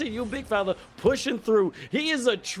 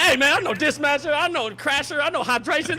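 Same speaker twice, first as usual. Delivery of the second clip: raised, higher voice in playful protest; mock indignant boast; 7 semitones higher, 4 dB louder than usual. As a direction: reel off names in mock indignation. Acting out own mock protest.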